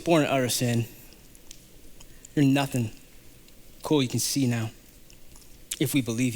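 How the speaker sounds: mock sneer. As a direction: dismiss him with low contempt. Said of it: "They're like".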